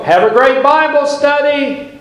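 high, chirpy imitation of a cheery friend; cheery greeting. Sylvia wishing the pastor a great Bible study, high and cheery. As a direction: toss off a bright good-luck wish. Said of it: "She was always so cheery".

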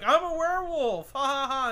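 high, loud mocking boast; hammed-up high poser voice. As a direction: sneer at a poser in a silly voice. Mocking a part-time werewolf.

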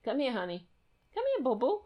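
soft, high coaxing to the cat. Real, not acted. Coaxing the cat with baby talk. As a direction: coax the cat over sweetly.

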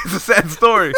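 hysterical laugh. Real, not acted. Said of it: "Why are you laughing so hard".

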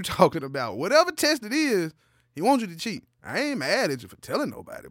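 normal talking voice

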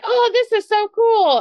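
put-on high, delighted child voice; excited little girl. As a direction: gush like a thrilled kid. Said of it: "Amelia's like".

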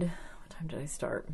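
whispering to herself; a quick whispered question; whispered aside. Real, not acted. Muttering to herself.

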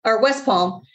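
ordinary talk

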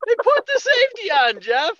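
very high, incredulous squeal; comic high-pitched exasperated outburst; outraged squeal. Retold or imitated. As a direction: repeat it at the top of your range. Repeats the reveal, escalating in outrage.

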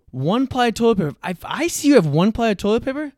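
ranting at guests with one-ply toilet paper, loud and high; high-pitched rant. Acted. Mock outrage at one-ply hosts, shouted high. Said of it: "That was a really good rant".

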